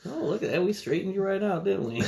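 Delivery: high, teasing doctor voice; mock doctor voice. Friend quoting the Forrest Gump doctor, high mock voice.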